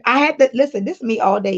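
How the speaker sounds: ordinary talk, nothing marked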